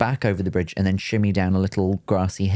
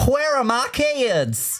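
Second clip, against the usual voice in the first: much higher, slow drawled Southern American; Southern American impression; much slower than usual. British host trying a Southern American accent for the guest. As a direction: stretch it out like a Southern mum. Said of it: "Let me try".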